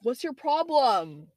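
high, drawn-out challenge; aggressive. Real, not acted. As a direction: call out an offender in exasperation. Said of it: "You guys just saw me get really aggressive".

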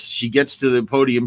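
ordinary delivery

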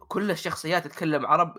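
normal talking voice

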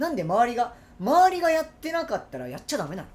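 mock-angry protest, high and loud; indignant challenge. Wife arguing with husband over sitting side by side, indignant. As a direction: push back hard, imitating an annoyed wife.

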